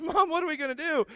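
put-on high, pleading little-boy voice; a scared boy asking his mom for help; boy asking his mom what to do after a fall, high and pleading; panicked kid. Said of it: "I looked at my mom and said".

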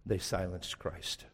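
solemn hush to the congregation; hushed solemn refrain. Real, not acted. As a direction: drop it low, soft and slow.

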